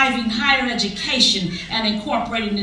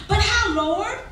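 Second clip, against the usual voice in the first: tired plea; 12 semitones higher, 4 dB louder than usual. Acted. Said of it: "she wonders in her tired mind".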